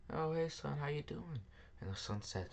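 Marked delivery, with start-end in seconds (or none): 0.1-1.4 a higher, bright-pitched hello from dad; cheery father greeting. Acted. Father welcoming his broke son back home, cheerful higher greeting. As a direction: greet him like a glad father.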